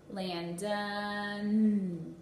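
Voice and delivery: "Landon" drawn out with the final N punched; stretched name. Shown as a demonstration. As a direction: stretch "Landon" and press the last N. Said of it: "stretch out their whole name and punch out the last sound".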